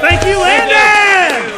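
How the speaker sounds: long, high, stretched shout. Real, not acted. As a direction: call out the thanks slowly. Host calling out thanks.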